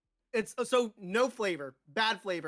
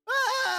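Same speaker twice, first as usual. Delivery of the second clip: high pained yelp; 5 dB louder than usual. Real, not acted. Spicy pain, sudden yelp.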